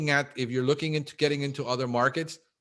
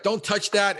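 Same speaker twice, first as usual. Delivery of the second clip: higher mock warning to a toddler; anxious new parent; 7 semitones higher, 3 dB louder than usual. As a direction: play the nervous first-time parent saying don't. Voicing a first-time parent.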